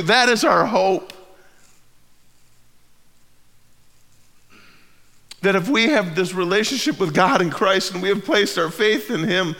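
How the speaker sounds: loud, high, impassioned preaching; fervent pulpit climax; preaching at full force. Real, not acted. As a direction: lift the room toward hope. Building sermon climb at full force.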